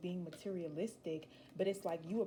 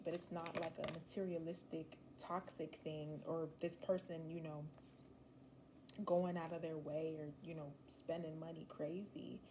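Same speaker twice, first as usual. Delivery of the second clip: ordinary delivery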